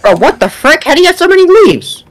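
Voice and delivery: shocked, squeaking high; high incredulous squeal. Real, not acted. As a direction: squeal it in disbelief. Amazed at friend's leaves.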